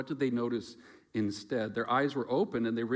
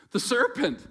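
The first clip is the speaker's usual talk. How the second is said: defensive blaming; 11 semitones higher, 4 dB louder than usual. Acted. Eve blaming the serpent.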